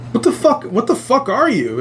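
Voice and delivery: high incredulous challenge, not shouted; retold alarmed disbelief. Dreamer confronting fake wife, retold high-pitched alarm.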